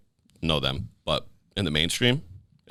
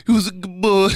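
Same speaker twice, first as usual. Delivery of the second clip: very high, loud mock gushing; dog-talk voice; 12 semitones higher, 5 dB louder than usual. Talking to humans like dogs, high dog-talk voice. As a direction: gush at a person as if a dog. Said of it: "talking to humans like that".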